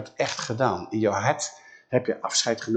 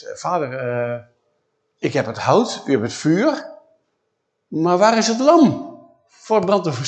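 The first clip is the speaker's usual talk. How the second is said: Isaac voiced; 5 semitones higher, 6 dB louder than usual. Isaac questions his father.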